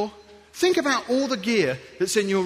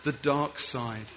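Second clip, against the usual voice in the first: low, soft, slow doom; ominous voice; 10 semitones lower, 7 dB quieter, slower than usual. Acted. Colleague's name for Microsoft, low and ominous. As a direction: say it like a film villain.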